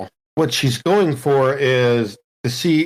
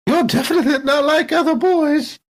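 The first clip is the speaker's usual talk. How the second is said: put-on high, fluttery old-lady exclamation; prim old lady; 13 semitones higher than usual. Jules reacting to the android reveal, prim old lady. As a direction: gasp it like a proper elderly lady.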